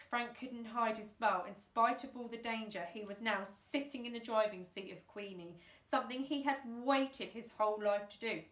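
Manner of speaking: usual speaking voice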